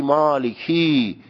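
مالکی with a long drawn final "eee"; wrong reading, "malikiii" stretched out; over-lengthened kasra. Shown as a demonstration. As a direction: slowly drag the last vowel of مالکی. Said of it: "اشباع اینه که بگی".

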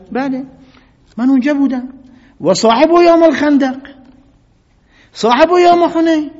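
fiery preaching cry of pride; defiant proclamation. Acted. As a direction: shout his record back proudly. Ibn Masud defiantly lists his merits.

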